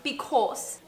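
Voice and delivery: brief try at a British accent; English accent attempt. Shown as a demonstration. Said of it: "Attempt an English accent".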